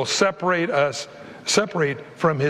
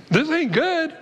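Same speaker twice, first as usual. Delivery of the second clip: voice of the brain; 11 semitones higher than usual. Retold or imitated. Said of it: "Your brain says".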